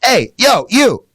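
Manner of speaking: high-pitched, separated commands to a dog; firm correction. Acted. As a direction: call the dog off, one slow word at a time.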